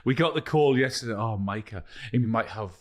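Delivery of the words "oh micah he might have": ordinary delivery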